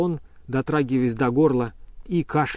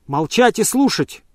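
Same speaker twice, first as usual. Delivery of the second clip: loud, higher, slow command; shouted order; 8 semitones higher, 6 dB louder than usual. A narrator's reading. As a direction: shout her down. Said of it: "крикнул Обломов".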